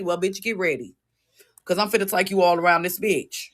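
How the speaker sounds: ordinary delivery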